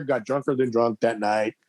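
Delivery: ordinary delivery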